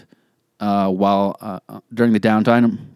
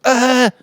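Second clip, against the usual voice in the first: startled yell; 4 dB louder than usual. Retold or imitated. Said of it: "It was like".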